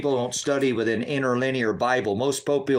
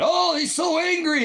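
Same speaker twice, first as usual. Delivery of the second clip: mocking the critics. Host imitating everybody who calls him angry, mocking. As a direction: mimic a critic in a high, wailing whine. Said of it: "Everybody always does that".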